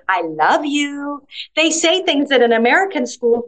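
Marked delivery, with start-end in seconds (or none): 0.1-1.2 tender teacher's love. Retold or imitated. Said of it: "they'll say".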